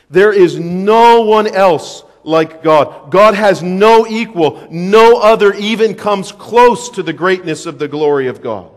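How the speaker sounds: loud, slow preacher emphasis; forceful preaching. Acted.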